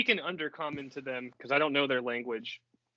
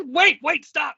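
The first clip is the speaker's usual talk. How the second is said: laughing yell; 9 dB louder, slower than usual. Acted. Laughing, yelling to stop.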